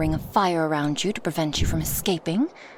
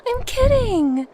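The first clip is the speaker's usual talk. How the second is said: much higher and louder, teasing cry; playful exclamation; 7 dB louder, slower than usual. Acted. Dragoness reassuring her startled passenger, playfully exclaiming. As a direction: call it out high to insist it was a joke. Insists it was only a joke.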